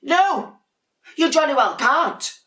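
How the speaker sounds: high, loud, indignant no; cross refusal. Acted. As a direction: slam the answer at the sisters. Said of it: "said Dudley".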